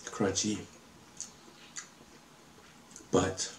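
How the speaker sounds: chewing between words; slightly muffled by food; talking while eating fries. Real, not acted. Seen 2: holds fries throughout, bites them between phrases while talking.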